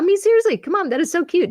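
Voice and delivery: squealing high over something cute; high, delighted gush; excited gushing. Real, not acted. Gushing over cute alien pop.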